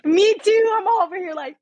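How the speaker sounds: high emotional laugh, near tears; teary laughing. Real, not acted. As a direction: laugh through happy tears. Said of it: "getting emotional".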